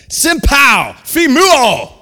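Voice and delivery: high, loud shouted rebuke in Greek; forceful acted command. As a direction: shout it to silence a storm. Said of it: "He said, the Greek says".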